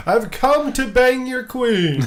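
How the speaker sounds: high, slow, cocky swagger; hammed-up Euron boast. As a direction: brag it like a preening fool.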